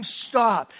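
ordinary delivery

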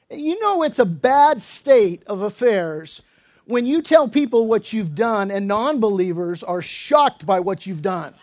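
ordinary delivery

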